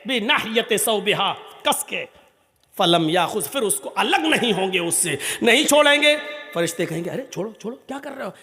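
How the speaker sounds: fiery storytelling sermon to the congregation; preaching at full force. Acted. Preacher tells the Judgement story.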